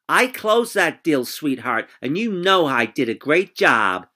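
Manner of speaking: high, over-the-top acting coach voice; a pushy agent sealing the deal, hammed up; husky businesslike voice. Sally boasting, same voice.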